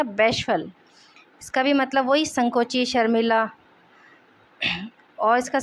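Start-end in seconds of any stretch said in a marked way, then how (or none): 0.0-0.7 बैशफल said as BASH-ful; dictionary-style बैशफल, BASH-ful; pronunciation demo. Shown as a demonstration. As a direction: model बैशफल clearly, BASH-ful with falling tune. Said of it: "इसका pronunciation हो जाएगा".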